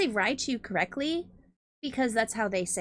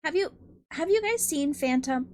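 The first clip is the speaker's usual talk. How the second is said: excited; 4 semitones higher than usual. Real, not acted. Excited asking chat.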